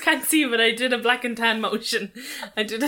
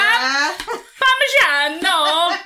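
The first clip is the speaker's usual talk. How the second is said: loud, high, drawn-out mock Italian; hammed-up Italian shout; shouted Italian accent; 6 semitones higher, 5 dB louder, much slower than usual. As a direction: holler it slowly like a cartoon Italian. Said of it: "It's you, it's you shouting".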